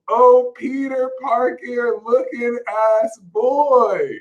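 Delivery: high, slow mimicking of the boy; imitated drawn-out call. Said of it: "saw this boy go like".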